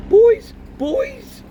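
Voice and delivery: distracted, thinking aloud; vague father. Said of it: "said Mister Weasley vaguely".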